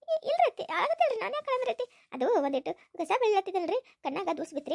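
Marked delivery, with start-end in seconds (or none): 0.0-2.1 tearful denial. Acted. Daughter-in-law denies crying to mother-in-law, high and cracking. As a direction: insist you're not crying while choking up. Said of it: "ಅಳಕತ್ತೇನ?".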